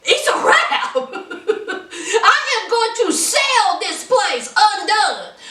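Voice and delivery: ordinary delivery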